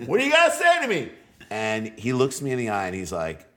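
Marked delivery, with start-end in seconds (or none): 0.1-1.1 much higher, louder and fast, puffed-up challenge; hammed-up tough-guy yell; mock loud challenge. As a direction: bellow it like you want the whole crowd to hear. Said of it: "Like, loud enough".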